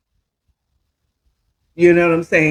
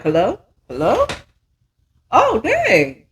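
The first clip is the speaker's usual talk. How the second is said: caught off guard; 5 semitones higher than usual. Retold or imitated. Phone picks up before ringing.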